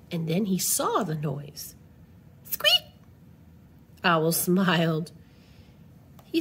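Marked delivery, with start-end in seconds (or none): 2.5-2.9 very high little squeak; sudden high-pitched peep; tiny squeak. Acted. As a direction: pipe it up like a tiny creature. Voicing the story's noise.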